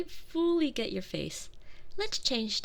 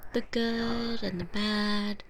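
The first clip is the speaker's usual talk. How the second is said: ordinary delivery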